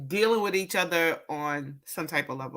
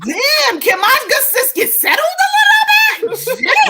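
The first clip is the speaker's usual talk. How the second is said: high, loud laughing shout; loud laughing greeting; 11 dB louder than usual. Real, not acted. Friend arriving, loud laughing greeting.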